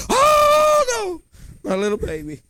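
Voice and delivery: high crying wail, loud and mock; doting parent wail. Parent running to fallen child, shrill mock wail. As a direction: overprotective parent fussing over child.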